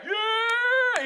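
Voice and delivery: very high, loud cheering shout; a big mock crowd yell of joy. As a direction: cheer like a whole cafeteria. Cafeteria cheers the news.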